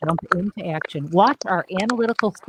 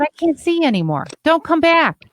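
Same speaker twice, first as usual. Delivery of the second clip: raised, louder voice ordering him out; retold yelling; 9 semitones higher, 5 dB louder than usual. Therapist shouting at her client to go. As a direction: throw him out of the office, hard. Telling addict client to leave.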